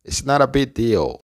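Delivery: "not a" joined as "nara" in "It's not a big deal"; linked pronunciation. Shown as a demonstration. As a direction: say "not a big" as "nara bi", t tapped and g softened away. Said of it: "Repite conmigo". ("not a" unido, como "nara")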